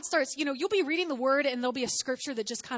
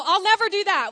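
loud, much higher, boastful; voice of pride; 8 semitones higher, 11 dB louder than usual. Retold or imitated. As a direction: puff up with self-assurance. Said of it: "Pride says".

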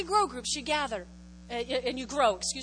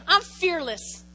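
call to repeat; 5 semitones higher, 10 dB louder, much slower than usual. Shown as a demonstration. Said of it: "Everybody say".

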